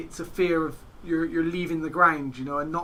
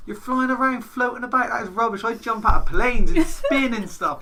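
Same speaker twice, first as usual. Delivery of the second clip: higher, scoffing at paragliding; mocking scorn; 6 semitones higher, 6 dB louder than usual. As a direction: brag about skydiving, mock disdain.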